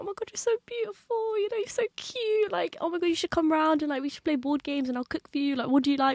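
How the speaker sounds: high, gushing auntie voice. Retold or imitated. Auntie gushing over her son's friend, high and sweet. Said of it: "She was like".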